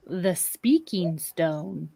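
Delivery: slow, low, hushed awe; name it slowly, as if sacred; soft, portentous unveiling; solemn reveal. Acted.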